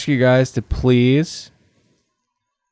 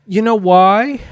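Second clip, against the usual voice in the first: much higher, disbelieving; incredulous; 10 semitones higher than usual. Acted. Host mocking the idea of researching the show, incredulous. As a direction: scoff at the idea.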